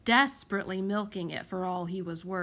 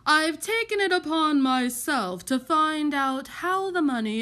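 loud, self-important aristocrat voice; pompous lord; 4 semitones higher, 5 dB louder than usual. A narrator's reading. Lord complaining about council spending, pompous and loud. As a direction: hold forth indignantly, leaning on the key words.

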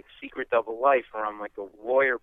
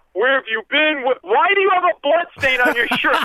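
much higher, louder outraged demand; furious senior partner; 12 semitones higher, 7 dB louder than usual. Retold or imitated. Senior partner grilling the lawyer, angry and loud. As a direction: apoplectic boss confronting a junior lawyer.

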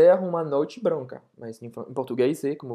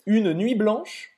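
quiz question; 5 semitones higher, 4 dB louder, slower than usual. Shown as a demonstration. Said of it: "Alguém sabe o que significa isso?".

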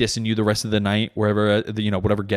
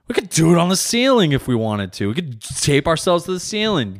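higher and louder, boasting; excited; 7 semitones higher, 5 dB louder than usual. Acted. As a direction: ramp up the bragging.

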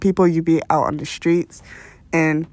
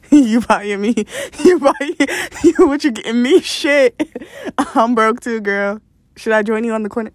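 playing broke friend, laughing; 3 semitones higher than usual. Voicing broke friend Vanessa, laughing.

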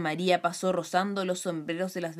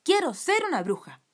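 grito testarudo; 6 semitones higher, 3 dB louder than usual. A narrator's reading. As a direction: insist stubbornly, pitched well up. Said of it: "Pataleó y gritó testaruda". (grito testarudo y agudo)

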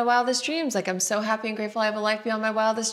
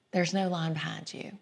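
hushed, breathy ghost-story whisper; spooky whisper; 3 semitones lower, 8 dB quieter than usual. Acted. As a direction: whisper it like a campfire tale. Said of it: "maybe it was a little bit of that".

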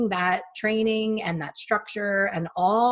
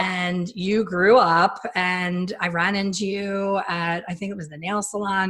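usual speaking voice

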